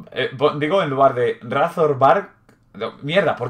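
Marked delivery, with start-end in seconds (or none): none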